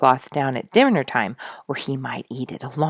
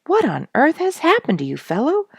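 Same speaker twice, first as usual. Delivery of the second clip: much higher, startled exclamation; exclaiming; 12 semitones higher, 5 dB louder than usual. A narrator's reading. Narrator alarmed at Duke's strange noises, exclaiming. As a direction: cry out in alarm. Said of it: "I exclaimed".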